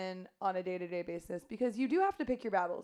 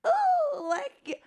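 very high, loud witch cry; 11 dB louder than usual. Shown as a demonstration. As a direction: shriek it like a cackling witch. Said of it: "I got a witch voice".

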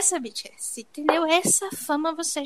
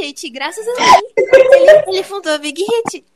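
excited boast; 6 semitones higher, 12 dB louder than usual. Real, not acted. Bragging climax of silly story.

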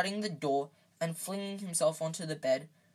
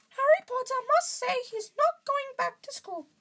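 very high, loud squeaky elf; high-pitched stubborn little creature; insistent house-elf; 5 dB louder than usual. A narrator's reading. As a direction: lay down the condition in a piping elf voice. Dobby's squeaky voice, issuing ultimatum.

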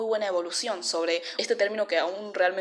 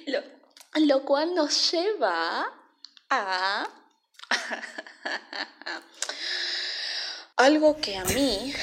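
slow, drawn-out suspenseful build-up; milk the pause before the reveal; dramatic suspense; 6 semitones higher, slower than usual. Acted. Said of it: "¿Cuánto suspenso?".

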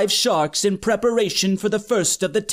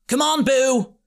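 higher-pitched call over a distance; calling across; 5 semitones higher than usual. A narrator's reading. Wilfred calls across the pool to Boo, urging. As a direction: urge a friend from far off. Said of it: "he called across the pool".

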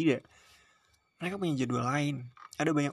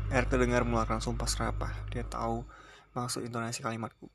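usual speaking voice

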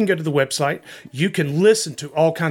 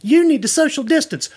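excited pitch; 7 semitones higher than usual. Acted. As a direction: sell it with a rush of hype. Excited mock sponsor pitch.

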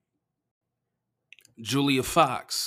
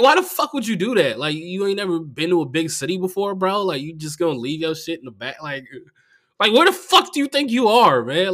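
loud, raised-pitch rant; exasperated scolding; 5 semitones higher, 5 dB louder than usual. Retold or imitated. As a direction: tell him off in disbelief. Berating the absent comic.

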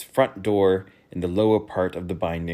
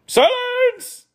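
very high, loud shouted order; king demanding silence; royal command; 8 dB louder than usual. Acted. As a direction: cut them all off. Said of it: "suggested the King".

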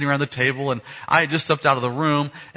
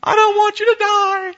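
very high, loud imitated wail; hammed-up sobbing child's howl; mock crying harder; 8 dB louder than usual. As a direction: bawl like a hysterical little girl. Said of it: "made his sister cry even worse".

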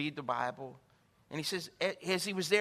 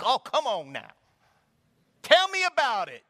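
loud, pitched-up invitation to share; loud eager come on now; eager church lady; 6 semitones higher, 10 dB louder than usual. Retold or imitated. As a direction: lean in with excitement. Cashier eager to hear.

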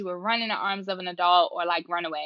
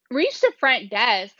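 ordinary talk, nothing marked

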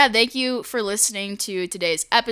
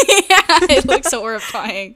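laughing through the words; words break into real laughter; 5 semitones higher, 9 dB louder than usual. Real, not acted. Laughing at video call.

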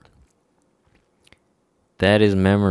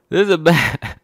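raised, higher, exasperated voice; real indignation; 9 semitones higher, 6 dB louder than usual. Real, not acted. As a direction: hammer it out, indignant and loud. Worked-up rant about the map.

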